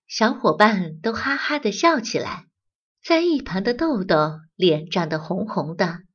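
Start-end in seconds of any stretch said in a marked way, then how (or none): none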